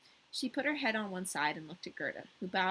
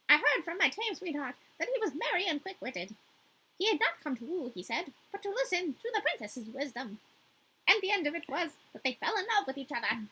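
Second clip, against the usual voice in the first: a lightly voiced chatty crow, higher; 8 semitones higher than usual. The crow answering Gerda in a light crow voice. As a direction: croak it out as a talkative crow. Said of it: "I didn't really expect to have to do this voice".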